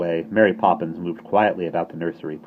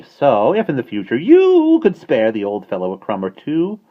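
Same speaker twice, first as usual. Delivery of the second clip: coaxing starling; 3 semitones higher, 9 dB louder than usual. Acted.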